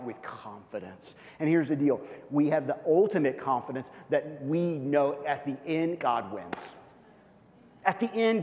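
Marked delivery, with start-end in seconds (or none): 6.0-6.8 "God wins" dropped low and firm; low, certain; low firm declaration. Acted. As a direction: settle it with certainty. Declaring the ending.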